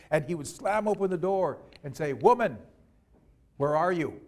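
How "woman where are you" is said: booming summons from the doorway; loud demand. A narrator's reading. Giant husband calls to wife on coming home, loud demand. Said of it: "in a very loud voice".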